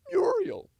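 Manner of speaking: very high thin cartoon voice; cartoon character voice. Shown as a demonstration. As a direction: call the name in a high squeak. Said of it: "I was doing a warm up".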